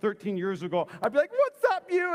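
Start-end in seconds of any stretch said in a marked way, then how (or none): 1.3-2.1 very high, loud mock-cheery hello; overdone greeting. Preacher greets student whose name escaped him, high overdone cheer. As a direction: cover forgetting with a big greeting.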